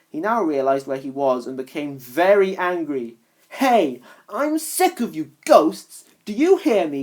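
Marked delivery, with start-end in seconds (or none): 3.6-7.0 furious, louder and higher; snapped. Acted. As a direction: yell at the ghosts, fed up. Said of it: "he snapped".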